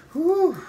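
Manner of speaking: high, loud blown whew. Real, not acted. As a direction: blow out the heat. Reacting to burning heat.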